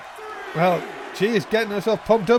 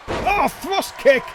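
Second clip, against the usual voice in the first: excited call; 7 semitones higher, much slower than usual. Real, not acted. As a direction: shout the kick. Live wrestling commentary.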